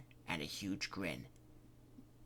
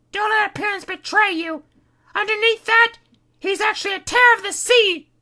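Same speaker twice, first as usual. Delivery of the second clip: put-on much higher, grave warning girl; Kitty's voice; 13 dB louder than usual. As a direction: warn them darkly. Kitty voice held.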